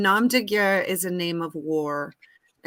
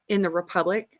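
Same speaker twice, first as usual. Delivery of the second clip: normal talking voice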